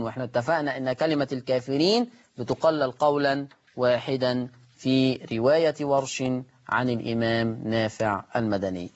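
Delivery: usual speaking voice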